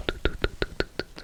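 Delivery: close-mic trigger words, barely there; right in your ear. Shown as a demonstration. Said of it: "ma manie à faire de l'A S M R".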